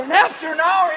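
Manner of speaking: ordinary delivery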